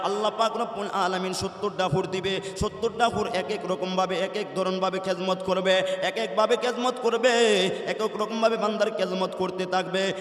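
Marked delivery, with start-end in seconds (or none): none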